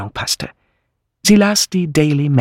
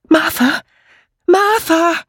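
much higher calling voice; 4 dB louder, slower than usual. Acted. Amy calling her friend from afar, high call. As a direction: call across the garden, high.

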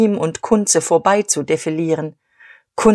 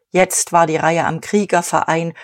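ordinary delivery, nothing marked

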